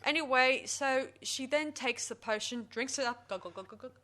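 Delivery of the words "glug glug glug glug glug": low, soft gulping "glug" sounds; glugging noises. Retold or imitated. Reteller mimics her drinking the potion, soft glugging. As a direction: imitate someone gulping a drink down.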